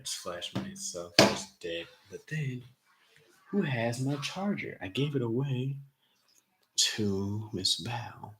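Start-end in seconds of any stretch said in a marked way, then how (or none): none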